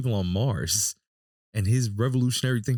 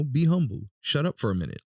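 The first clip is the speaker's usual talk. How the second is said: ordinary talk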